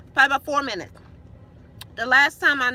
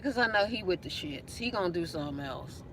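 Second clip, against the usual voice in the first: quiet worried warning; 6 semitones lower, 10 dB quieter than usual. Retold or imitated.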